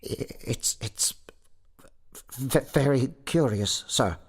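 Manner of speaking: halting, frightened stammer; half dead with fright. A narrator's reading. Sexton humours the goblin, stammering with fright. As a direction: stutter from faint to high-pitched in fright. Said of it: "half dead with fright".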